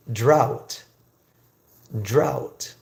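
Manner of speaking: "drought" said alone, carefully, with a pause after; pronunciation demo. Shown as a demonstration. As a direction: model "drought" as one careful syllable for learners. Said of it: "This is pronounced".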